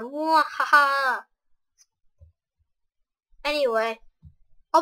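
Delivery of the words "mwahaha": slow hammed-up villain cackle; slow, drawn-out mock evil laugh. Mock villain laugh after one-liner.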